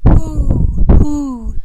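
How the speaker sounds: loud owl hoo; owl hooting. Acted. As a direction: hoot it like an owl. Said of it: "said the wise old owl".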